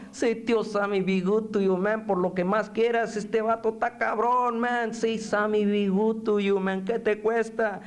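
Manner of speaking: high, wheedling barrio voice; pleading sidekick. Acted. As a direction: coax the prisoner in a high voice. Coaxing figure in poem.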